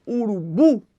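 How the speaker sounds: URUBU with the weight on final BU; oxytone stress. Shown as a demonstration. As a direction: demonstrate "urubu" stressed on its last syllable. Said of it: "a primeira sílaba da direita". ("urubu" oxítona, tônica no BU)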